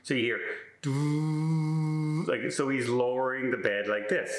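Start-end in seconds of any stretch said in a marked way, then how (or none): 0.8-2.3 imitated motor whirr; bed motor hum.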